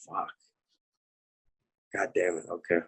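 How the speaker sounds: soft, low, halting resignation; retold stunned acceptance, barely voiced; deflated muttering. Retold crushed reply to the scolding.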